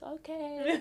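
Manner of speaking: high laughing okay; amused agreement, high. Retold or imitated. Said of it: "And I was like".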